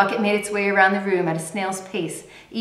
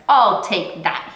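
slow, louder menacing snarl; 6 dB louder, much slower than usual. Acted. Thief snatching the food bucket from the girl, snarling slowly. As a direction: growl it slowly to take the bucket. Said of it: "he snarled".

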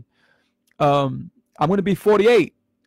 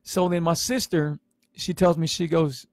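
normal talking voice

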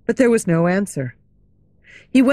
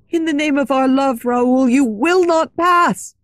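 urgent plea; 6 semitones higher, 4 dB louder than usual. Acted. Christine blocks the door pleading.

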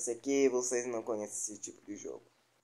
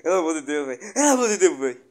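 building panic; 3 semitones higher, 7 dB louder, much faster than usual. Real, not acted. Panicking at the monster.

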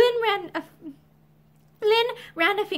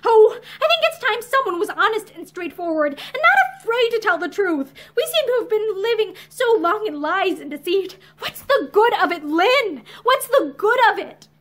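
high, loud, impassioned plea; passionate outburst; 6 semitones higher, 6 dB louder than usual. A narrator's reading. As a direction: burst out, pressing hard for honesty. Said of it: "Violet broke out passionately".

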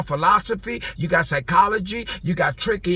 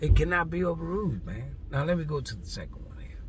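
ordinary talk, nothing marked

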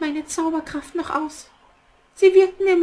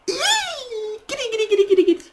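evil witch cackle; 4 semitones higher, faster than usual. Acted. Witch gloats over plan.

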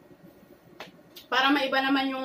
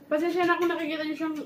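usual speaking voice

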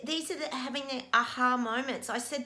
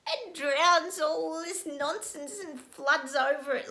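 teary; 6 semitones higher, slower than usual. Real, not acted. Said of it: "made me so teary and sad".

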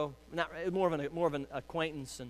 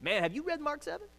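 retorting; 10 semitones higher than usual. Retold or imitated. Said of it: "And I go".

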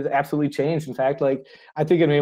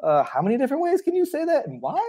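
much higher, incredulous mock question; mock bewilderment; 11 semitones higher than usual. Learner baffled by German articles, mock incredulous. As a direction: play up baffled disbelief. Imitating bewildered English speaker.

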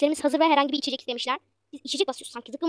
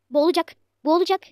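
alarmed cry; 3 semitones higher, 8 dB louder than usual. Real, not acted. Chicken drowning in sauce, alarm.

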